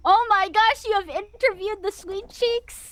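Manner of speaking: loud, high-pitched fan excitement; excited amazement. Real, not acted. Guest learning host interviewed her favourite VTuber, thrilled outburst. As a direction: burst out in real starstruck surprise.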